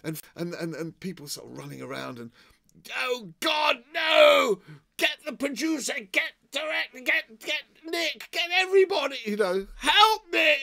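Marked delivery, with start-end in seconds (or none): 3.0-10.6 much higher, very loud frantic calls; screaming crew in panic; mock panicked shouting. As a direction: yell for help in fake alarm. Panicked crew calling for help.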